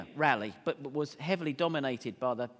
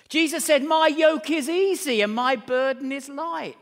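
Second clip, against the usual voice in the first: loud, high preaching voice; scripture proclamation; 10 semitones higher, 11 dB louder than usual. Real, not acted. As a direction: ring out the verse to the congregation.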